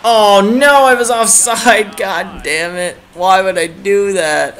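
frustrated gamer outburst; exasperated outburst. Real, not acted. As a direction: wail at your own mistake, dragging out the last words. Upset at going offside.

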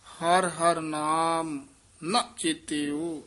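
slow phrase, stopping on ਚੇਤਿਓ; rest after ਚੇਤਿਓ. Shown as a demonstration. Teacher marking the rest, slow line stopping after ਚੇਤਿਓ. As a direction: draw it out to ਚੇਤਿਓ, then rest. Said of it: "ਇੱਥੇ ਵਿਸਰਾਮ".

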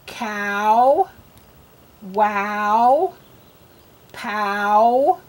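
"Cow, wow, pow" each stretched on the OW, rising; isolated "Cow, wow, pow", long lifted vowels; drilling OW words. Shown as a demonstration. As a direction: draw out each OW with a rising end. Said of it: "words that have ow".

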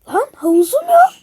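shocked outcry. Acted. Shocked the pool is gone.

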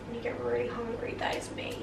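hushed whisper. Real, not acted. Whispering to viewers about being hungry. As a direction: confide it in a whisper. Confiding hunger.

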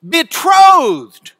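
"Betrothed" very loud and high, second syllable stretched; emphatic single word. Shown as a demonstration. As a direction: punch out "Betrothed" like a headline. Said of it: "Greek word order for emphasis".

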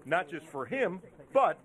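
low, measured TV voiceover; news feature narration. A narrator's reading. Reporter profiles the calm trainer before the Derby, low feature voiceover.

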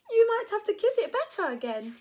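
high, loud, merry teasing; laughed. Acted. Preston teasing Max while sailing, high laughing tease. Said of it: "laughed Preston".

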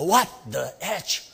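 acted indignant challenge; mock outrage. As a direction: draw it out in comic disbelief. Repeating the outraged reaction.

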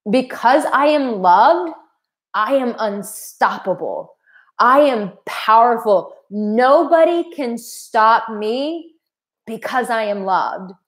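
slow, emphatic affirmation with drawn-out key words; empowered declaration. Retold or imitated. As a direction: declare it like a personal mantra. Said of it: "I remember saying".

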